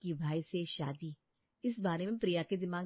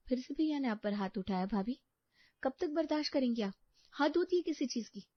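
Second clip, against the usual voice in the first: put-on outraged young woman; 7 semitones higher than usual. Sister-in-law outraged for Priya.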